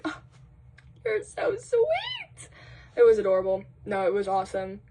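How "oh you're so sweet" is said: very high, drawn-out squeal; melting, high-pitched gush; retold gushing. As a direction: coo it slowly way up high. Retold gushing reply to friend.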